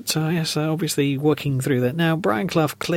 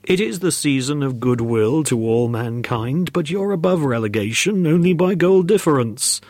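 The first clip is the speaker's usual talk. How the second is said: admonishing ghost; 3 dB louder than usual. Acted. Ghost warns strikers about relegation, solemn rising proclamation. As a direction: proclaim it as a spirit warning the team.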